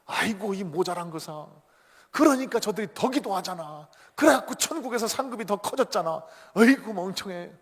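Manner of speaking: mock-furious boss, rising in pitch and volume; acted anger. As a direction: berate a useless underling. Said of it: "그랬더니 또 막 화를 내는 거예요".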